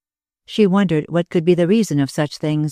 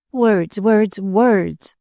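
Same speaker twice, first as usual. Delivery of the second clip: higher, theatrical Hamlet-style repetition; mock Hamlet; 5 semitones higher, much slower than usual. A narrator's reading. Dora mocking her lover's promises, in the manner of Hamlet. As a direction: toss off each word like a brooding Hamlet. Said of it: "after the manner of Hamlet".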